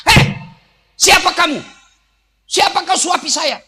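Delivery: high, loud angry challenge; a blind man pushing away a stranger's hand, angrily loud; angry outburst. Acted. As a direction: demand to know who this stranger is.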